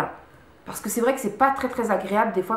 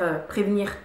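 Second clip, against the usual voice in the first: usual speaking voice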